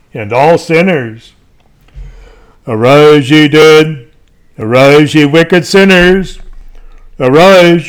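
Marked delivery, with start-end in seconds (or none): 2.7-6.4 louder, booming call to judgment; preacher roaring the last trumpet; thundering summons. Acted. 7.2-7.9 loud, higher stern command to rise; fire-and-brimstone call; thundering summons. Acted. Summons to the damned.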